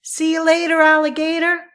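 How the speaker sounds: bouncy "See you later, alligator"; playful goodbye. Shown as a demonstration. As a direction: toss off a cheery rhyming farewell. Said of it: "Have you ever used these".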